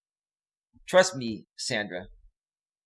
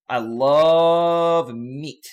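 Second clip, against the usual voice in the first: stretched out very slowly, louder; slow drawn-out; 4 dB louder, much slower than usual. Shown as a demonstration. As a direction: drag every word out slowly. Repeats line slow and drawn out.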